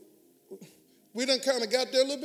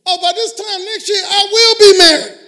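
rising to a loud, higher, almost crying cry; shouted decree; 7 semitones higher, 12 dB louder than usual. Shown as a demonstration. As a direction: proclaim it with fervour. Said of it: "You gotta decree it".

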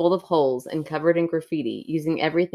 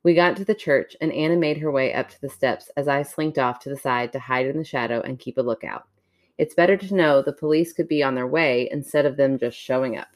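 story narration; 2 semitones lower than usual. Acted. Reads a creepy story aloud.

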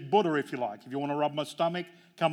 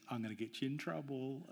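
put-on hushed kid's threat, much softer; quiet sly threat; 13 dB quieter than usual. Little brother softly threatening to tell on him. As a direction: murmur the threat slyly to your brother. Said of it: "And then Gabby goes".

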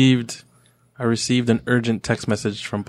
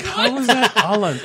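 laughing disbelief; 10 semitones higher than usual. Retold or imitated. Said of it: "I was like".

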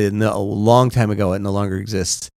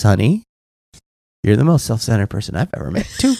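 understated, lower-key delivery after a sigh; retold deadpan confirmation; 3 semitones lower, 3 dB quieter, faster than usual. Wife answering upset husband, understated deadpan confirmation. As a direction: let the verdict drop gently and quickly.

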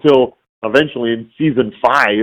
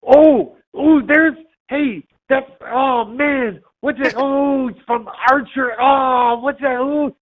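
high-pitched, halting mock fan; flustered recognition; 14 semitones higher, slower than usual. Fan spotting an Archer actor on the street, flustered recognition. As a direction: grope for the name in high-pitched excitement.

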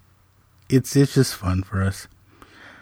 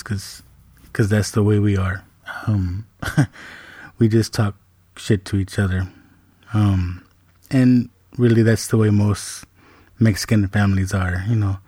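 normal talking voice

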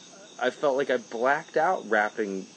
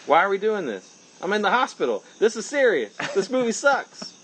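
higher and louder, building; rising exasperation; 10 semitones higher, 4 dB louder than usual. Pretend. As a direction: build from complaint to outrage. Mock hospital complaint, building.

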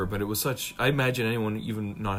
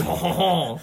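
mock French accent; 6 semitones higher, 6 dB louder than usual. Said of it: "your Nemoidian's French".

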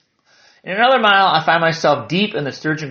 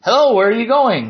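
put-on higher-pitched Yooper boy; thick Yooper accent; 8 semitones higher, 4 dB louder than usual. Blonde boy asks a hiker, thick Yooper accent. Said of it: "in a thick Yooper accent".

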